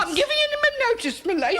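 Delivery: pitched high and loud, wounded pride; upset cook announcing she's leaving, high and loud; indignant outburst. Acted. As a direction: fling the notice at her, voice rising. Cook quits in a fit.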